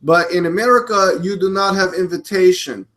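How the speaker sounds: put-on Russian accent. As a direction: do an amateur Russian impersonation. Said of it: "I'm trying to do a Russian accent".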